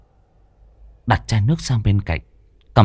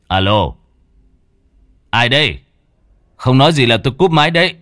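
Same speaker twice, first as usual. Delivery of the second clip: irritated threat; 3 semitones higher, 5 dB louder than usual. Acted. Irritated caller threat into silence.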